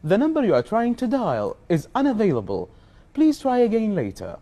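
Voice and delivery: very slow recorded operator voice; robotic error message. Shown as a demonstration. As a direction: stretch each word like an automated line. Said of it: "يلا، خلنا نسمعها".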